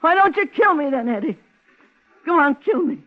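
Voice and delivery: loud, high, defiant cry; goading him to shoot, near breaking; anguished challenge. Acted. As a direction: dare him, voice cracking with pain. Woman daring him to kill her.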